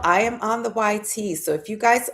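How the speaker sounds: ordinary talk, nothing marked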